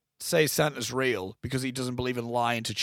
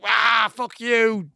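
high, slow squawking duck voice; Donald Duck quack; 7 semitones higher, 6 dB louder, much slower than usual. Retold or imitated. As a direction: squawk it like an angry cartoon duck. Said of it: "proper Donald Duck".

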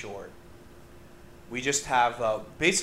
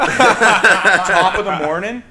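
loud, slow mock Irish accent through laughter; laughing Irish accent; 6 semitones higher, 13 dB louder, much slower than usual. Podcaster mocking the Irish bar to friends, laughing Irish accent. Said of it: "he's Irish".

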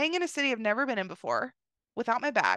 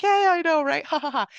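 laughing agreement; 5 semitones higher, 6 dB louder than usual. Retold or imitated. Joking agreement with driver.

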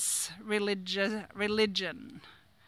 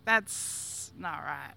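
low, slow, soft inner voice; 7 semitones lower, 6 dB quieter, much slower than usual. Retold or imitated. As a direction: mutter it to yourself, much lower and softer. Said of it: "internally I'm going".

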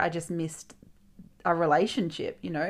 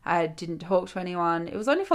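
ordinary talk

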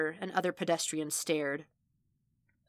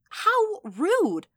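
high, loud, exaggerated outrage; mock affronted; 7 dB louder than usual. Vanessa miming shock for Wade on the phone, exaggeratedly affronted. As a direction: swoop up high as if theatrically taken aback. Mock offence at Wade's complaint.

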